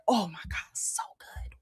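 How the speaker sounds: awed, unvoiced whisper; hushed raving. Real, not acted. Host dreaming of mixed bowls to viewers, whispered rapture. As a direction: whisper it as if savoring the food. Savoring the thought of food.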